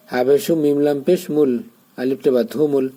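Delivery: ordinary talk, nothing marked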